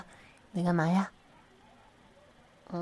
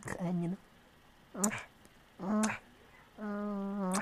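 ordinary delivery

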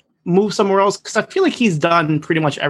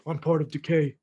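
Vin Diesel impression; 8 dB quieter than usual. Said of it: "he's like".

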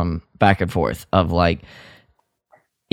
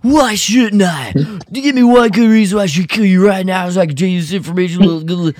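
anime protagonist outburst; 9 semitones higher, faster than usual. Retold or imitated. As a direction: play the melodramatic anime hero demanding a reason. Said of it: "ever the anime protagonist, goes".

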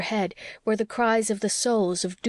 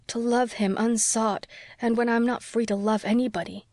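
usual speaking voice

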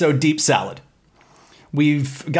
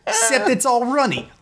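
put-on Italian-American accent; 11 semitones higher than usual. Italian mama cooking bit.

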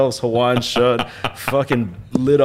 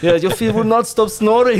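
higher-pitched mock Eastern European accent; 9 semitones higher, 4 dB louder than usual. Backpacker girl complaining about the snoring intruder, mock Eastern European accent. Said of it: "It's like Eastern European".

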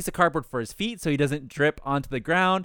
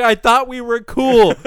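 slow, higher, louder mock hurt plea; mock betrayed friend; 7 semitones higher, 9 dB louder, slower than usual. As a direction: ham up the betrayal, slowly. Voicing betrayed homeless guy.

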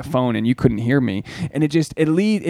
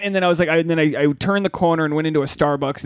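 ordinary talk

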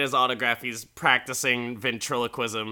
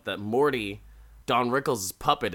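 usual speaking voice

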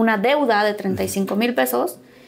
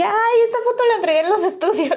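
high, surprised gasp of a memory; mock alarm at herself; startled realization; 10 semitones higher than usual. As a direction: voice jumps up in sudden dismay.

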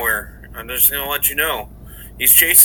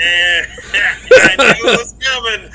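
laughing; 6 semitones higher, 10 dB louder, slower than usual. Real, not acted. Laughs at joke about him.

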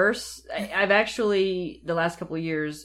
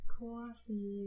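soft, drawn-out New York "coffee"; mimicked New York accent; 5 semitones higher, 12 dB quieter than usual. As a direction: echo her New York CAW-fee quietly. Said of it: "are you actually from New York?".

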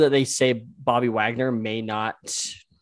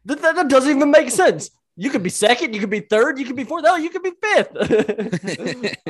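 high, loud, laughing protest; laughing disbelief; 14 semitones higher, 5 dB louder than usual. Real, not acted. Cohost laughing at the host's excuse, high and loud. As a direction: squeal it through laughter.

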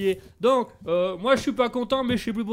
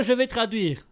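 normal talking voice

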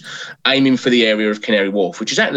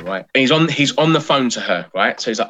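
ordinary delivery

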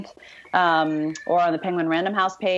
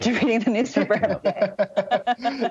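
laughing; 6 semitones higher than usual. Real, not acted. Laughing at her own repeated answer.

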